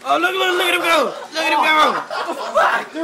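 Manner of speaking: loud high cheering; excited yelling. Real, not acted. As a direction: cheer the fighter on. Cheering the fight.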